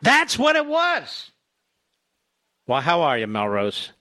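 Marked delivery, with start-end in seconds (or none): 0.0-1.3 much higher and louder, stretched out slowly; delighted realization. Real, not acted. Host suddenly placing the caller's name, exclaiming with delight. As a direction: land the aha moment. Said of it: "sudden recognition".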